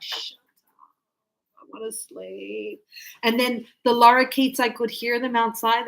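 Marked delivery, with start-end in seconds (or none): 0.0-2.8 whispered, slow sleepy complaint; sleepy grumble. Retold or imitated. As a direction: grumble it half asleep under the breath. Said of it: "and I was like".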